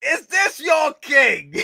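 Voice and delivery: high, slow, loud shouted challenge; shouted taunt. Pretend. Streamer shouting Loki's taunt, high and slow. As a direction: bellow it like a villain's cry.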